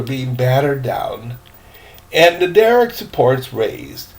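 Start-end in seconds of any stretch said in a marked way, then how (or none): none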